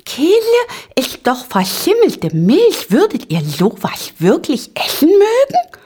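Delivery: very high, squeaky disgust; tiny aghast voice rising high; horrified Ping-Pong. A narrator's reading. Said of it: "ganz entsetzt".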